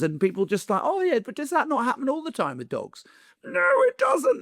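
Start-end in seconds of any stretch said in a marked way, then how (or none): 0.8-3.0 a much higher, lightly put-on shrug of a question; mock dismissive voice. People excusing a lost dog, in a higher mock-casual voice. 3.4-4.4 very high, louder mock outrage; indignant retort. Answering people who excuse lost dogs, in high comic outrage.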